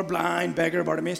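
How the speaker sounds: ordinary delivery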